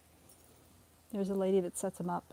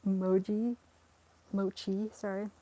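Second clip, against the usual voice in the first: ordinary delivery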